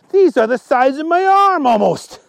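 high, loud astonishment; acted disbelief at the size; shocked exclamation. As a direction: blurt it out high and aghast. Reaction to the worms' huge size.

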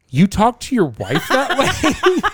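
high, slow, laughing disbelief; incredulous coworker. Retold or imitated. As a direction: ham up the rep's shock, drawing it out. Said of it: "my rep will look at me and be like".

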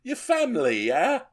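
put-on British accent. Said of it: "that's British".

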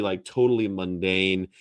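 normal talking voice

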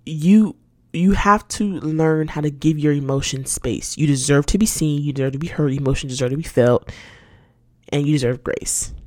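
ordinary talk, nothing marked

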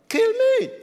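preacher's scream pushed to its peak; imitated scream. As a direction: let the second cry break up high. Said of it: "this man started screaming at me".

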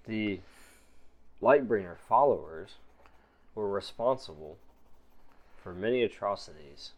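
a guarded stranger weighing her words; quiet suspicious woman; mysterious stranger. Acted. GM voices stranger.

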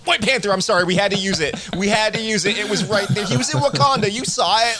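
high pleading apology, played for laughs; mock apologetic showrunners. Writers apologizing to fans, high mock pleading. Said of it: "They were like".

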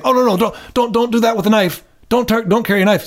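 mock scolding parent. Scolding a toddler with knife.

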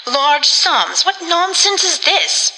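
high-pitched, indignant disbelief; exasperated. A narrator's reading. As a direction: protest in exasperation, pitch jumping up. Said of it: "repeated the exasperated Tabitha".

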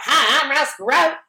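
imitating Ross Perot's Texas accent; Texan accent. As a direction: put on a big Ross Perot Texan twang. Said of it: "talking like this".

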